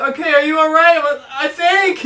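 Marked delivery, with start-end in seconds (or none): 0.2-1.0 high, loud bystander voice; acted urgent call. Storyteller repeating guys' question after crash, acted urgent call. Acting bystanders checking on him. 1.3-2.0 high, louder imitation of himself; mock strained reply. Storyteller voicing his reply to the guys, mock strained.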